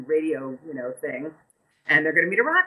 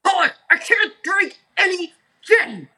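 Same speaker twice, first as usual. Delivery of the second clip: high, loud, fed-up shout at the waiter; exasperated angry yell; 11 semitones higher, 5 dB louder than usual. Retold or imitated. Rock star yelling at a clueless waiter, loud and exasperated. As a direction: blow up, half laughing, half furious. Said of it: "And [name] goes".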